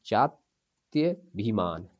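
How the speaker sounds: जात्यभिमान said very slowly with a short ya; teacher drawing out जात्यभिमान syllable by syllable; correct word slowly. Shown as a demonstration. Teacher to students, slowly spelling out the word. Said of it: "छोटा या आएगा यहाँ पे".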